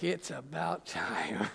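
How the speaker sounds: low, slow, under the breath; mock weary relief. Congregation as the long sermon nears its end, mock weary relief. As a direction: drag it out softly as a weary aside.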